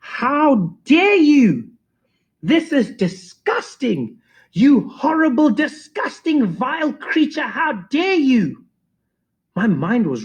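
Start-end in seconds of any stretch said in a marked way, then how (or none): 0.0-8.6 high, slow outraged shriek-voice; teacher scolding the DJ, hammed-up fury; livid teacher. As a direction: play the furious teacher, hammed up. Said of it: "she was livid".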